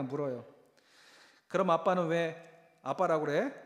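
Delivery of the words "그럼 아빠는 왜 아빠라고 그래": 높고 크게 묻는 아이 목소리; 신나서 묻는 꼬마처럼. Retold or imitated. 아이 목소리로 또 묻는 장면. (높고 큰 아이 흉내 질문)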